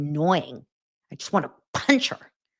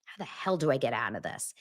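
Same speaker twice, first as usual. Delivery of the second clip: hushed, much softer mock panic; whispered panic; 4 semitones lower, 9 dB quieter than usual. Groom panicking to himself, whispered and soft. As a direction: whisper it like he's trapped. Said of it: "He's already like".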